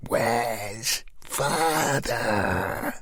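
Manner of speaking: very slow, eerie and flat; very slow haunted voice; possessed voice. Acted. Possessed Katrina repeats.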